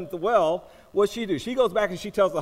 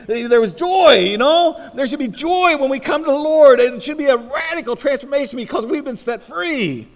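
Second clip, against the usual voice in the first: loud, higher pulpit exhortation; forceful, joyful preaching; 6 semitones higher, 7 dB louder than usual. Acted. Preacher urging the church to joy in the Lord, forceful preaching. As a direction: proclaim the joy with full force.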